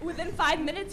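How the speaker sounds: normal talking voice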